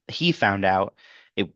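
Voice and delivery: normal talking voice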